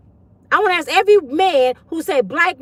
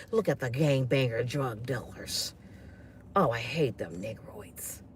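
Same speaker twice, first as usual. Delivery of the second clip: much lower, quieter, slow and sneering; 13 semitones lower, 15 dB quieter than usual. Retold or imitated.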